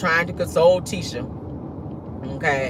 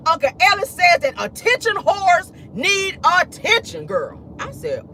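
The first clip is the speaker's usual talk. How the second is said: normal talking voice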